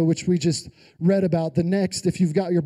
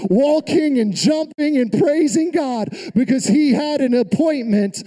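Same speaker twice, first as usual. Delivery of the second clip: raised, exultant sermon voice; full-force preaching; 5 semitones higher than usual. Real, not acted. Preacher telling of the lame man walking, full-force. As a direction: drive the miracle home, punching key words.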